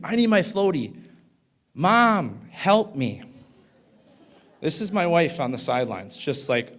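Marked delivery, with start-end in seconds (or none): 0.0-3.2 put-on higher and louder, panicked child calling mom; half-acted scream of a scared boy; retold kid scream for mom. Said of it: "And he's screaming".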